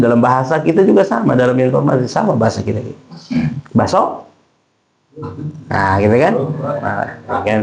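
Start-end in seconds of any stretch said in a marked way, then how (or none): 3.7-4.3 "Baso" higher and rising; question intonation. Shown as a demonstration. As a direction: ask "Baso" as a one-word question. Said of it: "Tapi ketika intonasinya pertanyaan".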